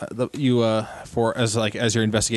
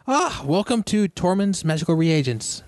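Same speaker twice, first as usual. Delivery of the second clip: higher, slow shopkeeper greeting; halfling shopkeeper; 8 semitones higher than usual. Acted. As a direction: welcome customers as a halfling merchant.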